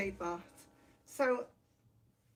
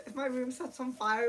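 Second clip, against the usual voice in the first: normal talking voice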